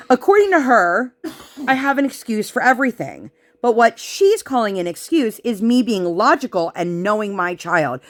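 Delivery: ordinary talk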